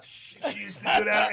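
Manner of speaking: usual speaking voice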